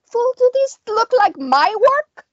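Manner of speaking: high-pitched snarling witch; angry snarl. A narrator's reading. Witch to the fighter who blames her, snarling. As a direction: snap back at him, high and indignant. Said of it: "The witch snarled".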